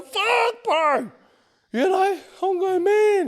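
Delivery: very high, drawn-out exclamations; high-pitched mock freak-out; alarmed protest, re-enacted. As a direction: act out panicked disbelief at a friend. Alarm at seeing the gun.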